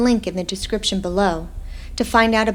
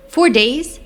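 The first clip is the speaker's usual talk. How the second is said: a sharp, higher, louder question; shocked disbelief; 7 semitones higher, 7 dB louder than usual. Acted. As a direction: hit it like the news just landed. Shocked on waking from coma.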